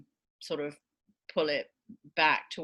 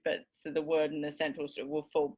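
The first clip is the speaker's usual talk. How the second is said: normal talking voice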